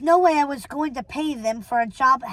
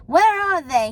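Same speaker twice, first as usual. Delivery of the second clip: higher, louder accusation; raised, insistent man on the phone; demanding; 5 semitones higher, 5 dB louder than usual. A narrator's reading. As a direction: demand an answer forcefully. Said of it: "he demanded".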